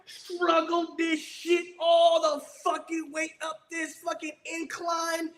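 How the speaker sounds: high, slow straining voice; mock strain. Mocking gunny straining under the pack. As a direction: act out straining up a hill.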